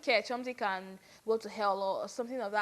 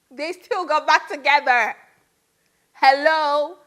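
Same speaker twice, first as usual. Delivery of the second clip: incredulous outburst, much louder; loud disbelief; 4 semitones higher, 13 dB louder than usual. Real, not acted. Vlogger stunned the couple got back together, loud disbelief. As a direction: cry it out in exasperated disbelief.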